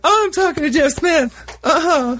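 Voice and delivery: high, tight squeal of a starstruck fan. Retold or imitated. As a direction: squeak it out like you can't believe who you're meeting. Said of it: "I was just like".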